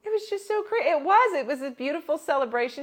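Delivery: very high, loud gushing. Retold or imitated. Friend describing the cemetery gig, high and loud.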